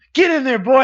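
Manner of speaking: loud, high holler sending a player in; hearty coach yell. Retold or imitated. Coach orders injured player in.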